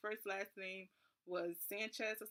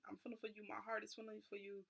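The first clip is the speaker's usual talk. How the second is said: much softer, tender reassurance; soft consoling; 9 dB quieter, faster than usual. Retold or imitated. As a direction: murmur it like comforting a stranded girl. Worker consoles her.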